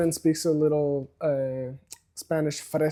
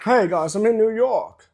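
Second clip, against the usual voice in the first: hammed-up New York accent, pitched up; 6 semitones higher, 6 dB louder than usual. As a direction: badly fake a loud New York accent. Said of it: "There's an accent for New Yorkers".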